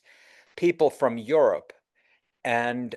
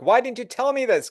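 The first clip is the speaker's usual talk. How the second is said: upset client; 9 semitones higher, 4 dB louder than usual. Retold or imitated. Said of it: "they go like".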